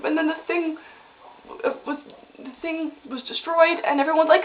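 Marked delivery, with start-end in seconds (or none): none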